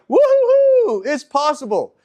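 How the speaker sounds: high, loud whooping joy of sudden discovery; gleeful whoop. Retold or imitated. A guy who just discovered pickup, whooping with excitement. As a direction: whoop it like a kid who just found the secret. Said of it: "it's like".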